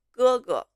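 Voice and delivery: teacher models 哥哥, first tone then neutral tone; tone demo. Shown as a demonstration. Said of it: "the first 哥 has the first tone".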